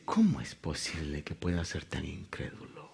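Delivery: hushed, incredulous whisper; acted whisper of dismay; whispered disbelief. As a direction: whisper it as if quietly grieved by someone's doubt. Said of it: "es como cuando usted mira algo y dice".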